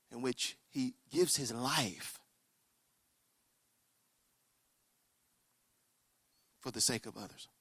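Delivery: low, very soft, solemn narration; quiet, weighty sinking tone; hushed storytelling. Real, not acted. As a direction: give it gravely, with long pauses.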